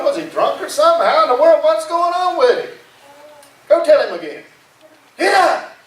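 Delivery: high-pitched incredulous demand; acted exasperation building to a sharp order; exasperated captain. Captain ordering the sailor to wake Jonah, exasperated.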